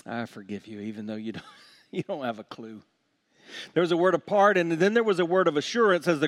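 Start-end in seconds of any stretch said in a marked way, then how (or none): 0.0-2.8 much softer and lower, breaking into a laugh; tender forgiving voice. Retold or imitated. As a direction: forgive them with an amused hush. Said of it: "God looks at us that way".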